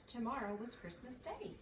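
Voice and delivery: ordinary delivery, nothing marked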